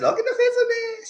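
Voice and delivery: very high, teasing; mock teasing falsetto. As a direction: needle him in a squeaky voice. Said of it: "I said".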